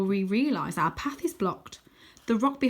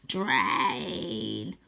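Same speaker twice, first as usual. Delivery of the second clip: low hiss; 9 semitones lower than usual. A narrator's reading. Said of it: "hisses Croaky".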